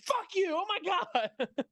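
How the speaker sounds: very high squealed retelling, breaking into laughter; mock outraged outburst. Retold outburst as prank revealed.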